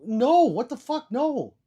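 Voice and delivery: drawn-out retold yell; mock angry refusal. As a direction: bellow the refusal in mock outrage. Retold quarrel over the Coke.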